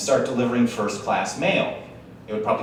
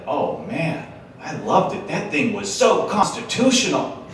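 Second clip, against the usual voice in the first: enthusiastic praise; 7 semitones higher, slower than usual. Retold or imitated. Himself praising the sandwich.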